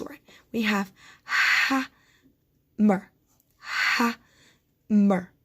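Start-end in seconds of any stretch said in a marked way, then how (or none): 1.2-3.1 "hammer" as "ha ... mer", long gap between; hammer, one syllable at a time; split into syllables. Shown as a demonstration. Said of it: "We can hear two syllables". 3.6-5.3 "hammer" as "ha ... mer", long gap between; hammer, one syllable at a time; split into syllables. Shown as a demonstration. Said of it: "We can hear two syllables".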